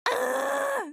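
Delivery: normal talking voice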